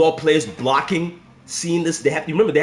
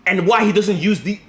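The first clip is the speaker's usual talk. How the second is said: ordinary delivery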